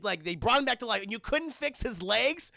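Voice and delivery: ordinary delivery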